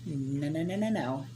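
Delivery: ordinary talk, nothing marked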